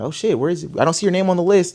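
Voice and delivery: alarmed surprise. Retold or imitated. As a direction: scramble to find the name. Staff can't find his name.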